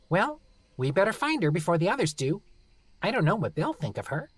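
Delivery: high, cheerfully menacing gnome. Acted. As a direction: sweetly threaten to bury him.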